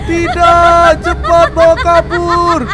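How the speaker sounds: loud, frantic cry to get away; panicked plea. Acted. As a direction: beg the driver in a panic. Begging driver to flee ghost. (jeritan panik minta kabur)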